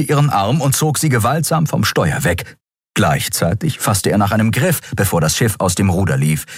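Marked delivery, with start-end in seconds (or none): none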